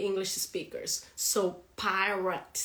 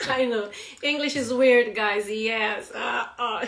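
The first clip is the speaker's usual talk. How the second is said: amused and breaking into laughter; laughing; 2 semitones higher, 6 dB louder than usual. Real, not acted. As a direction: talk through your giggles. Laughing at her own demonstration.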